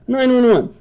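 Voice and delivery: "Nine one one" repeated in high scoffing disbelief; mocking the "Nine one one" idea; incredulous.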